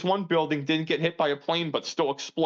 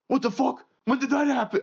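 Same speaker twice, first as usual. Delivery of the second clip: retold shock; 8 semitones higher than usual. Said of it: "I was like".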